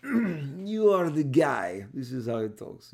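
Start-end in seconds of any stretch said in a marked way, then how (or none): 0.0-1.8 put-on slow, loud, heavy foreign accent; impersonating a boss with a thick accent, slow and emphatic; Avi Arad heavy accent. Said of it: "He talks heavy accent".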